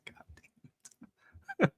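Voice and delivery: whispered, drawn-out slow curse; muttered curse. Real, not acted. Fan reading his team's Wikipedia page, whispered despairing curse. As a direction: breathe it out under your breath in despair.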